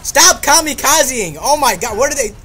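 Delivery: alarmed shout. Real, not acted. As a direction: bellow at them to stop rushing. Enemies charging at him.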